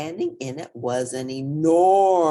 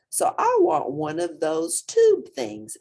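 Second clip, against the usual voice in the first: eager wish; 12 semitones higher, 5 dB louder than usual. A narrator's reading. Portly wishing for trunk.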